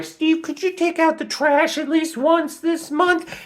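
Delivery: very high, slow falsetto nag; whiny high wife voice, dragging the request out; mock-nagging wife asking her husband to take out the trash. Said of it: "Marcia's like".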